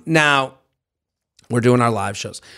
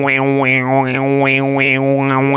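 didgeridoo drone imitation; 4 semitones lower, much slower than usual.

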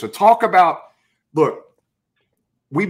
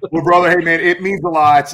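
excited thanks; 4 semitones higher, 7 dB louder than usual. Real, not acted. Host thanking guest with enthusiasm.

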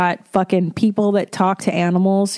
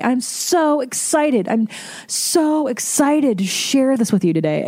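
over-excited radio host; 3 semitones higher than usual. Acted. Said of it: "they always start their fucking shows by being like".

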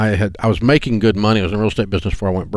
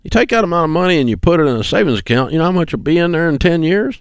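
higher, fast lecturing grandpa; 7 semitones higher than usual. Retold or imitated. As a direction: rattle off the lesson like a know-it-all elder. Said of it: "He goes".

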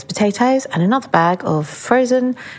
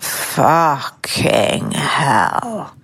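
slow, drawn-out groan of horror; disgusted groan at mirror; 3 semitones lower, much slower than usual. Retold or imitated. To herself in the mirror, a slow disgusted groan. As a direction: groan it at your own reflection. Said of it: "just went".

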